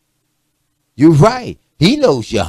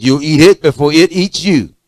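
louder, emphatic parental warning; firm warning; 6 dB louder than usual. Retold or imitated. Parent insists the child eats the food, firm and loud. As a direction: lay down the law at the dinner table. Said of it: "I said".

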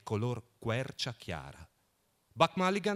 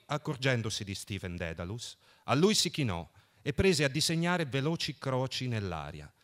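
plain reading voice

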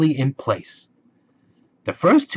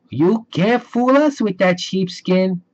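pigs crying out; 7 semitones higher than usual. A narrator's reading. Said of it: "they cried".

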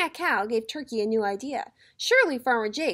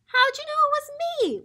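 wailing turkey; 13 semitones higher, 3 dB louder than usual. A narrator's reading. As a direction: wail it up high as a found-out turkey. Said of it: "wailed Turkey".

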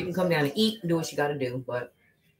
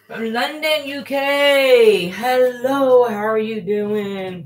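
high, loud sing-song hello; excited greeting; 7 semitones higher, 8 dB louder, much slower than usual. Real, not acted. Greeting a London viewer on her live, excited.

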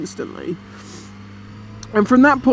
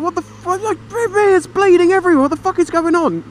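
high, louder alarmed outburst; panicked shout; 10 semitones higher, 3 dB louder than usual. Retold or imitated. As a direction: panic at the bleeding cat. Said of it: "'cause I'm like".

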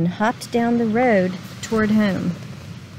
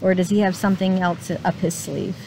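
plain reading voice

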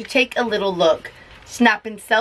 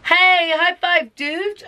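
high, slow friendly vampire call; cheerful vampire shout; 8 semitones higher, slower than usual. Acted. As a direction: greet him like a party vampire. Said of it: "a vampire shouts".

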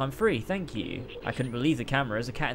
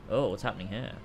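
usual speaking voice

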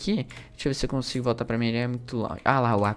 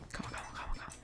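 whispered calma; 20 dB quieter than usual. Real, not acted. Tense moment, calming himself.

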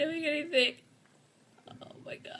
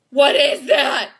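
loud, slow, stretched-out demand; alarmed shout; 2 semitones higher, 11 dB louder, slower than usual. Real, not acted. Player staring at the unknown thing, slow alarmed shout. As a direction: shout each word slowly in alarm.

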